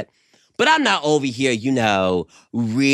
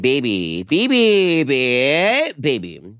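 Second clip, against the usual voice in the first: mock lovey baby talk; much slower than usual. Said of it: "it was all like".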